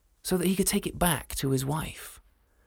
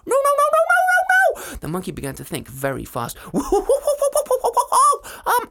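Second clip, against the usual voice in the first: very high, loud whimpering monkey; panicked monkey; 7 dB louder than usual. Acted. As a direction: babble no like a frightened monkey. Monkey panics then thinks.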